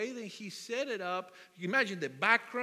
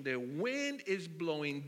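ordinary talk, nothing marked

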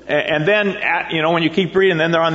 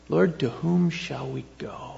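slow, much softer "Lord, to whom shall we go?"; soft reflective; 8 dB quieter, slower than usual. Shown as a demonstration. Pastor lingering on Peter's words, soft and slow. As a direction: let the question hang gently. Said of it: "This question".

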